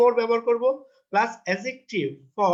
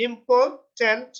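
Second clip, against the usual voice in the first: Important slowly split as im-por-tant, raised and louder; syllable by syllable; 5 semitones higher, 5 dB louder, slower than usual. Shown as a demonstration. Teacher counting one, two, three in a word, syllable by syllable. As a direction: sound out each syllable of important firmly. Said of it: "এক, দুই, তিন".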